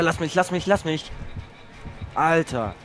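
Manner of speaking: ordinary talk, nothing marked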